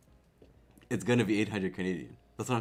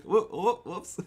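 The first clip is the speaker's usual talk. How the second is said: high, loud sudden whoa; startled whoops; 11 semitones higher, 8 dB louder, much slower than usual. Retold or imitated. Streamer reliving a near purchase, startled whoa. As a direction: jolt at a near mistake. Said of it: "I was like".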